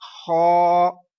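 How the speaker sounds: खौ drawn out slow in a full heavy voice; teacher modelling a heavy खौ; heavy khau. Shown as a demonstration. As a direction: say खौ thick and long. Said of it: "मोटी आवाज में पढ़ाना है".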